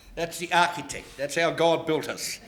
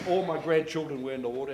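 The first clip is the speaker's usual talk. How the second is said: normal talking voice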